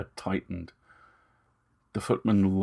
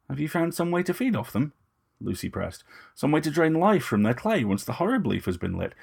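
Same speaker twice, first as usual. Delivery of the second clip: lightly voiced young woman, a touch higher, cool and composed; Lucy's measured, slightly raised voice; Lucy, poised young woman; 8 semitones higher than usual. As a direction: speak as Lucy, politely defiant. Lucy presses Moust.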